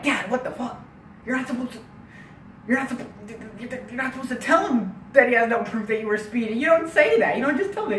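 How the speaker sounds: high, incredulous scolding; exasperated son. Retold or imitated. Acted son scolding his dad.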